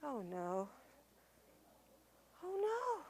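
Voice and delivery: hushed, worried oh no; quiet dismay. Acted. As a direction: let the worry sink in softly. Stories missing from book.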